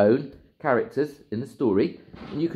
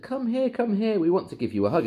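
lightly voiced eager old couple; coaxing old folks; 12 semitones higher than usual. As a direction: coax him in, high and fond. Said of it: "little old lady and little man said".

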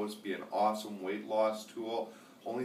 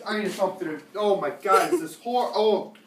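gagging, shrieking disgust at taste; real revolted squeals after tasting; after bitter medicine; 12 semitones higher, 13 dB louder than usual. Real, not acted. Just tasted vile powder.

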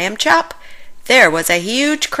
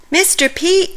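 higher call across the shop; 11 semitones higher, 4 dB louder than usual. Acted. Stanley calls for Pete in the messy bakery, raised voice. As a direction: call for him into the kitchen.

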